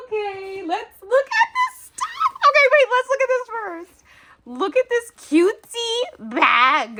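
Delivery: very high, loud cutesy squeal; excited squeal. Real, not acted. Vlogger to viewers over a cute bag, squealing.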